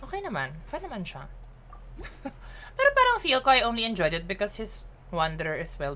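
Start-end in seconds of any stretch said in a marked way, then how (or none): 1.7-2.7 breathy laugh. Real, not acted. Laughs at chat.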